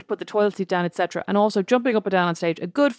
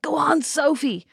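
encouraging dad; 8 semitones higher, slower than usual. Retold or imitated. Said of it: "Dad was like".

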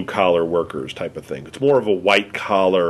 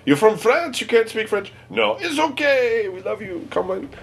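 much higher, sing-song imitation of a welcoming local; high, hammed-up voice of a fawning Québécois; mock Quebecer; 13 semitones higher than usual. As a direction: gush like an eager Quebecer. Mocking Quebecers welcoming the French.

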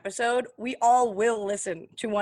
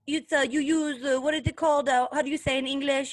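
louder, slightly higher mock fake-Spanish accent; imitating fake Spanish accent; 4 semitones higher, 4 dB louder than usual. Host mocks the celebrity's interview clip, fake Spanish accent. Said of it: "when she was like".

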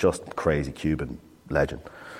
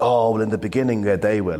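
higher, louder, a little sheepishly hopeful; mock-innocent self-quote, pitched up; naive eager reply; 5 semitones higher, 4 dB louder than usual. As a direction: play your younger self as innocently keen. Retelling his own naive reply.

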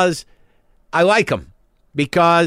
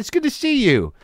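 bright, friendly hello; friendly greeting; 9 semitones higher than usual. Shown as a demonstration. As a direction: greet them like an old pal. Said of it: "See how that works?".

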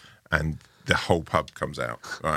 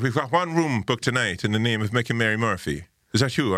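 imitated Mayo landlady, raised pitch; Mayo accent; 5 semitones higher than usual. B and B woman checking the booking, Mayo accent. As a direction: play the Mayo landlady in a higher lilt. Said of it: "I'm gonna do this accent".

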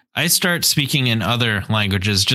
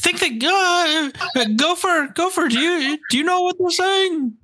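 Winnie the Pooh voice. Acted. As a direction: wonder aloud like Pooh bear. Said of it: "You're getting the Winnie Pooh think".

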